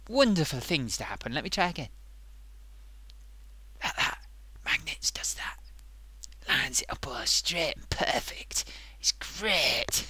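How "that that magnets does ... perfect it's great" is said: soft whispered marvelling at the trick; hushed, awed whisper. Acted. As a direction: whisper as if sharing a wonder. Hushed demo of the magnets.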